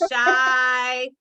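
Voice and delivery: "Shira" high and drawn out, stretching SHY; drilled long I. Shown as a demonstration. Host teaching listeners the I, "Shira" stretched as SHY-ra. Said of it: "There's an I in that".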